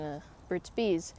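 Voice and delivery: ordinary delivery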